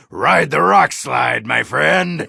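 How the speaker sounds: put-on high, slow, loud drunken rallying cry; rowdy cheer. Dwarf loudly cheering his friend on through a drink. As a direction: whoop it up like a toast.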